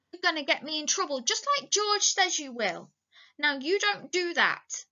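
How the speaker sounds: high-pitched fretful scolding; childlike cross complaint, acted; angry. A narrator's reading. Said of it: "Then Lenny grew angry".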